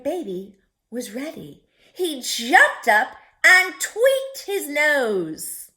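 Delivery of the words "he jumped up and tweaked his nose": excited storytelling. A narrator's reading. Dramatic read-aloud action line.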